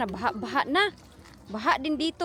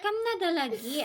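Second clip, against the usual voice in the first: usual speaking voice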